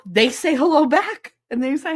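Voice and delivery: gasp, then high, loud fake wonder; mock amazement. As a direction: marvel theatrically as if at a miracle. Mocking the miracle of phones.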